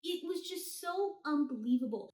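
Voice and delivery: normal talking voice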